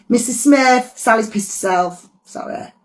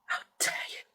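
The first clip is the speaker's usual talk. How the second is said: unvoiced, distant hiss; eerie whisper; 20 dB quieter, faster than usual. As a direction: whisper it from far off. Ghostly interjection, whispered.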